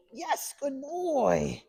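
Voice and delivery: put-on high, stretched, bubbly good boy; excited praise. Shown as a demonstration. Trainer charging up the recall, high excited praise. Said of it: "We wanna be real excitable".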